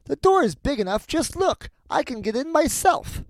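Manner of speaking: very high, impatient witch voice; scornful old witch. A narrator's reading. Witch shows Gretel the oven door, high scornful voice. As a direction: show her up in a high, swooping voice. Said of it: "said the old woman".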